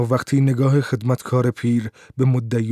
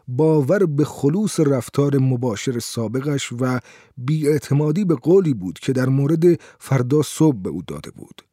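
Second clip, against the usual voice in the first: ordinary delivery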